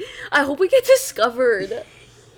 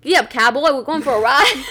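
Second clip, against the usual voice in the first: louder cowboy twang, southern; southern western accent; 3 semitones higher, 5 dB louder than usual. Shown as a demonstration. Going first in the challenge, southern accent. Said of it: "let's do a southern".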